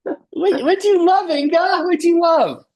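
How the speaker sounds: very high, loud playful squeal; excited falsetto cheer. Real, not acted. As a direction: squeal the question in a sustained high pitch. Facilitator playfully prompts Inga's answer.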